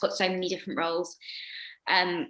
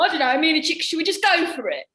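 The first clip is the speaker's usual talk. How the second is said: tag; 7 semitones higher, 5 dB louder, faster than usual. Retold or imitated. Pianist's flustered reply mid-finale.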